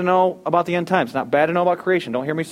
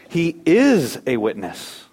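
"is" drawn out slowly and leaned on; stress on IS; much slower than usual. Shown as a demonstration. Preacher contrasting being with doing, heavy stress on "is". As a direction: stretch "is" and hit it hard. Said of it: "I can't emphasize that enough".

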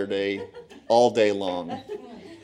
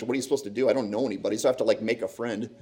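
usual speaking voice

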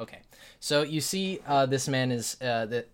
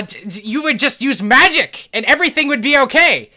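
loud, higher cranky rant; ranting old wizard; 9 semitones higher, 15 dB louder than usual. Acted. Inventor ranting to the party about the city, loud cranky. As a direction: rant against technology like an old mage.

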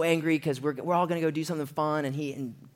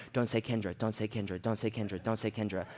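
low, softer repeated self-warning; muttered self-coaching; 5 semitones lower, 7 dB quieter than usual. Retold or imitated. Coaching himself in his mind, low muttered repetition. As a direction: chant it under your breath, low and even.

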